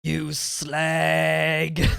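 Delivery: drawn-out Cockney insult; mock Cockney. Joking along with slang lesson, drawn-out Cockney. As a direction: stretch it out in a hammed-up Cockney.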